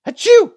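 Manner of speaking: high, loud mock sneeze; imitated sneeze. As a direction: fake a sudden sneeze.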